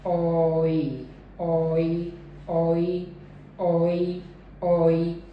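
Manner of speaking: ओई as one smooth syllable, "o" gliding into "ee"; diphthong sound demo. Shown as a demonstration. Teacher giving the transcribed sound, sustained gliding ओई. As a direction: repeat ओई evenly, each one separate and drawn out. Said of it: "और जो इसकी sound है".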